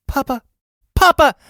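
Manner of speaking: put-on high, loud child calling out for papa. Little girl running to greet her papa, calling out.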